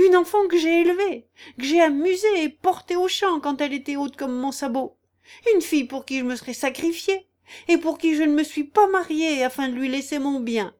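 high, loud, declaimed lament; feigned despair. Acted. Aunt declaiming her sacrifices for her niece, mock despair. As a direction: squawk out a show of martyrdom. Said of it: "déclamant et feignant de se désespérer".

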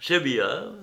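ordinary delivery

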